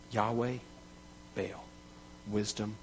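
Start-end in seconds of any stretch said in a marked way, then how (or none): none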